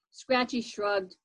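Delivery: ordinary delivery, nothing marked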